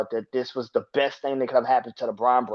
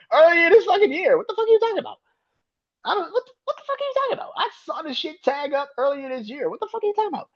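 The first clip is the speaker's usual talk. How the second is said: laughing high disbelief; faster than usual. Real, not acted.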